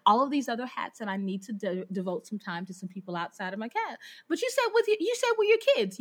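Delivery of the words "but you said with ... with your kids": high-pitched mock kid objection; protesting student. Students objecting to teacher's family time, high mock protest. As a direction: imitate a pupil catching the teacher out.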